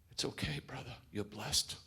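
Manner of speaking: a hint of a kind friend consoling; lightly voiced comforting friend. Preacher voices Samuel comforting him.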